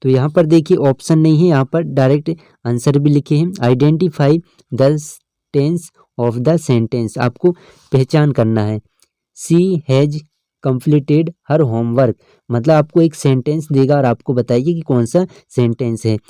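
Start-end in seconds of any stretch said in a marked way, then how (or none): 3.5-7.2 each word read out slowly for the class; slow reading aloud. Shown as a demonstration. Teacher reads the question slowly to the students. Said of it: "teacher reads the question". 9.4-12.1 sentence read out slowly, word by word; slow reading aloud. Shown as a demonstration. Teacher reads the example sentence slowly to students. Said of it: "teacher reads example sentence".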